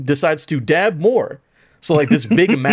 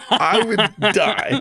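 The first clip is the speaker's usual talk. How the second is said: laughing; 8 semitones higher, much slower than usual. Real, not acted. Laughing at joke.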